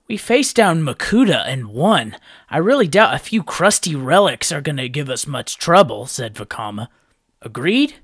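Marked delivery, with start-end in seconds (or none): 0.1-6.1 confident boast. A narrator's reading. Vakama boasting to team. 7.4-8.0 demanding. A narrator's reading. Vakama demanding assent.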